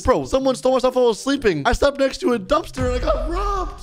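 high-pitched, indignant complaint; mock outrage. Player waking up robbed, mock outrage. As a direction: protest high, hammed-up disbelief. Wakes to find himself robbed.

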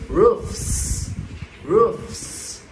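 "Roofs" with a long hissing F-S ending; final S sound. Shown as a demonstration. Teacher showing the class the plural, final S sound. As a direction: say "Roofs" twice, stretching the final sss. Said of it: "Thì nó sẽ đọc là âm".

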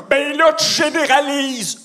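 high, slow whiny protest; mock outrage. Offended crowd objecting to the comedian's jokes, mock outrage. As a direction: drag out an offended complaint, hammed up. Voicing offended audience members.

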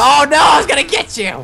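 high, loud teasing shout; playful shout. Real, not acted. Friend teasing the scared player, loud high shout. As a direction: shout in mock disappointment. Ghost chase game.

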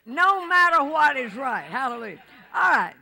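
loud, high call to the church; preaching shout. Real, not acted. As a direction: lift the room with it.

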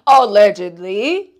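loud, drawn-out "allegedly"; pointed disclaimer. Real, not acted. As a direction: stretch it out loudly as a knowing disclaimer. Sarcastic disclaimer about snooping.

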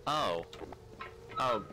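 ordinary talk

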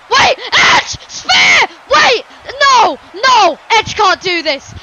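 high, loud bursts of shock; short, urgent ringside screams; alarmed shouting. Acted. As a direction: yell in protest at a sudden attack. Commentator reacts to surprise attack.

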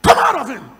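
high, loud command to the spirit; preacher reliving the exorcism, commanding the spirit out; commanding shout. Retold or imitated. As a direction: order it out with force. Casting out a demon.

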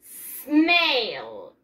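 "snail" drawn out with a long falling glide; "snail" as one long, clearly sounded word; pronunciation model. Shown as a demonstration. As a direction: stretch out "snail" slowly for learners to copy. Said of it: "¿Cómo se dice caracol en inglés?".

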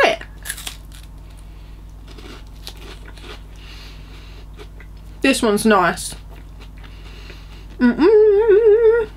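talking while chewing chocolate; muffled closed-lip speech; mouth full, chewing. Real, not acted. Seen 1: Bites bar at -5; closed-lip chewing through ~6.4s.

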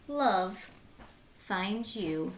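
usual speaking voice

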